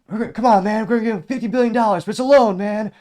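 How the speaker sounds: high, loud pleading mock voice; exasperated sales pitch, pushed loud and high; mock a wheedling politician, loudly; Biden impression. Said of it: "Biden just proposed".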